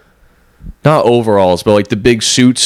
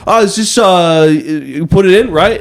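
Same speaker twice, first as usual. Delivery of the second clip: much higher, chummy bro voice to the cashier; same bro tone; 7 semitones higher, slower than usual. Retold or imitated. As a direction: talk to the cashier like an old buddy. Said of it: "the exact same tone he's been talking to me".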